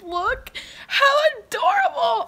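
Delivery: high, loud, drawn-out squeal of delight; gushing squeal. Real, not acted. Showing viewers the duck her friend drew, gushing squeal. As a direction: squeal over something unbearably cute.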